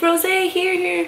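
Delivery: high, eager offering to the idol; nervous fan calling Rose over. Retold or imitated. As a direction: hold out the rose, pleading. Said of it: "I was just like".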